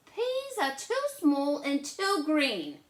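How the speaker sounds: high, loud fussy complaint; little sister refusing her peas; little sister Lola. Acted. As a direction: complain like a picky little girl. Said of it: "And Lola says".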